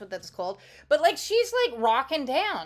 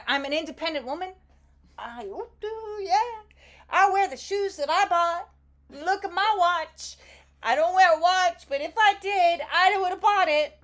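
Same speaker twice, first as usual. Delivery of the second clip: pitched-up, loud sassy diva voice; sassy independent woman; 7 semitones higher, 5 dB louder than usual. Pretend. Pretending to be the singer who buys her own gold, sassy diva voice. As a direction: strut it like a pop diva bragging. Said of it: "what is the one song that she talks about".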